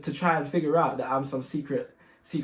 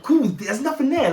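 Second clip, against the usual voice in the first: louder, higher, slow irritated cool; annoyed; 9 semitones higher, 7 dB louder, slower than usual. Retold or imitated. Fed up with the officer's search, annoyed. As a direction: push it out, fed up. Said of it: "I'm getting kind of annoyed".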